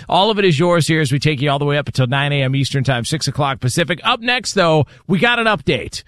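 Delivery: energetic on-air announcer read; radio host reset. Real, not acted. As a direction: sell what's coming up next on the show. Radio show reset and tease.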